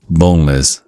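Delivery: usual speaking voice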